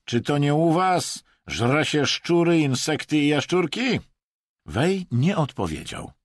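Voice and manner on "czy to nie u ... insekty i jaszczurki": mocking. A narrator's reading. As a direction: jeer with a rhetorical question. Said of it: "zakpił Wadim". (kpiąco, głośno i wysoko)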